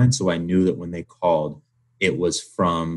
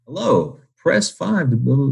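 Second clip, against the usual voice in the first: higher, sing-song automated recording; robocall voice; 7 semitones higher, 3 dB louder than usual. Retold or imitated. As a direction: mimic a canned robocall greeting. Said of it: "someone going like".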